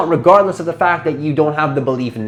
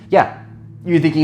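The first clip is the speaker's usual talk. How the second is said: ordinary delivery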